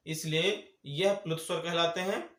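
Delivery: ordinary delivery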